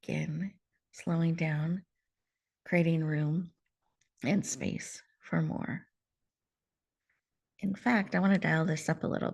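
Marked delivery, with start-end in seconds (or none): 0.1-5.8 guided breath. Real, not acted. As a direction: guide the exhale calmly. Guiding the breath exhale.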